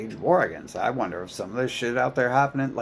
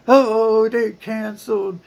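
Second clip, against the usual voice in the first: mocking petty complainer; 10 semitones higher, 5 dB louder than usual. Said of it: "it's always like".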